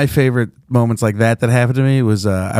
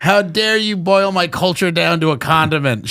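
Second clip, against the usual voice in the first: slow, higher indignant heckler; imitating an offended woman, stretching the words out; mock outrage; 4 semitones higher, slower than usual. Comic voicing the mad Polynesian heckler, mock outrage. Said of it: "she was so mad that she was like".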